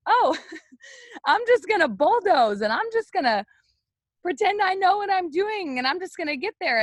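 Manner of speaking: high-pitched mock carefree voice; breezy mock bravado. Acting out her old bulldozing attitude.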